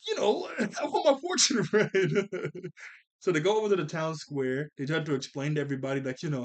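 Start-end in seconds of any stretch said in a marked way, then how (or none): 0.1-2.7 higher, slower, laughing through the words; laughing quote. Acted. Recapper voices character begging for a fortune, laughing. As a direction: act out the request, cracking up midway.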